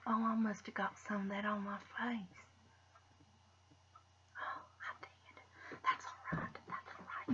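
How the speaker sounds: soft whisper, settling herself; whispered reassurance. Real, not acted. Vlogger whispering after finding stain on her face. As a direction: whisper it, brushing off the mishap.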